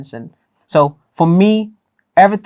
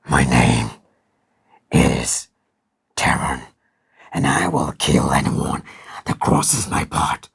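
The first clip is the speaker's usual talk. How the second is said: much lower, slow, menacing, ending in a whisper; villain voice; 12 semitones lower, slower than usual. Shown as a demonstration. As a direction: threaten like a dark villain. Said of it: "like a villain".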